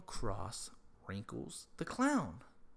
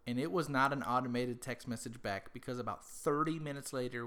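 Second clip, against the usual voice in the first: normal talking voice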